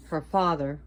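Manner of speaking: normal talking voice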